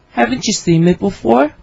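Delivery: ordinary delivery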